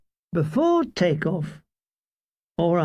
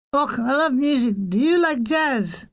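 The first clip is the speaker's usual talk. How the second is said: put-on higher-pitched, lilting Scottish accent; eager Scottish woman, pitched up; Scotch accent; 7 semitones higher than usual. A narrator's reading. Sergeant chats up the musician, high Scottish accent. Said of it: "said in broad Scotch accent".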